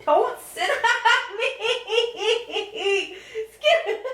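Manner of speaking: high, slow laughing protest; laughing at the dog. Real, not acted. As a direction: giggle him off you. Dog sitting on her.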